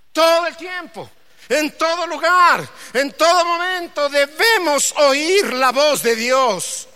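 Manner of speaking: high, loud, slowed preaching; preaching at full force. Real, not acted. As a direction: drive the point home to the congregation. Preacher raises voice.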